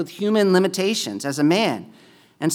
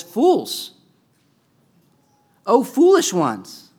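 Jesus rebuking; 6 semitones higher, 4 dB louder, much slower than usual. Retold or imitated.

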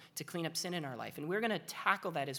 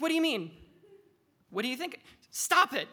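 exasperated yelling; 8 semitones higher, 7 dB louder than usual. Retold or imitated. Comedian yelling at patient.

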